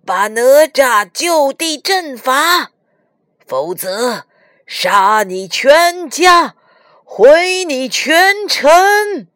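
loud, drawn-out dragon threat; menacing threat. A narrator's reading. Evil dragons threaten Li Jing's family, menacing. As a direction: stretch each threat slowly, rising in pitch and force. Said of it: "恶龙们威胁李靖".

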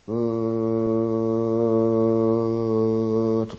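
drawn-out low moan, stretched very slow; mock distant moan. Storyteller imitating the distant moaning heard in the forest, slow eerie moan. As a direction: hum it like a far-off wailing voice.

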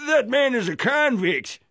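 put-on elderly diner calling out indignantly to the owner; indignant outcry. A narrator's reading. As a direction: call out in outrage at the owner. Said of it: "called out indignantly".

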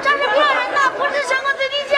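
high, insistent public shout; yelling out. Acted. Child defying the vendor, high insistent yelling. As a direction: keep hollering it for all to hear. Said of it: "你再喊，你再喊".

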